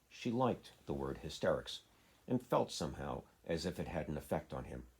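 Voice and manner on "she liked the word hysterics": usual speaking voice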